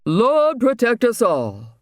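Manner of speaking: high, slowed gasp of shock; shocked exclamation. Acted. Reverend learning his cellmate is a pirate, shocked. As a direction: cry out to the Lord in alarm. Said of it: "exclaimed in shock".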